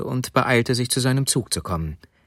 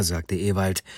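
usual speaking voice

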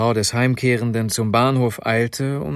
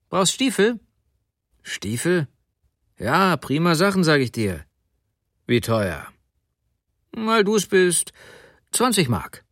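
lightly acted hawker, pitched up on the offers; higher, lightly played sales pitch; street haggling; 6 semitones higher than usual. A narrator's reading. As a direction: lightly voice a small-time dealer pushing goods. Thief haggles over stolen boots.

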